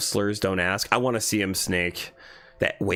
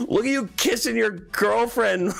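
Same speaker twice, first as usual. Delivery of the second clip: high-pitched words squeezed through laughter; laughing hard; 9 semitones higher than usual. Real, not acted. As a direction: tease while cracking up. Cracking up at the story.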